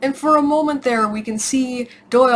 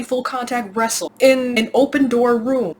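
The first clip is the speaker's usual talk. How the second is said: usual speaking voice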